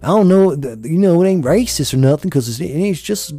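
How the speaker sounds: smart-ass twang impression. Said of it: "being a smart ass".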